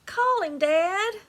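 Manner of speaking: high, pressing plea to dad; urgent plea. Acted. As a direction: beg him, voice rising high. Tearful girl begs her father.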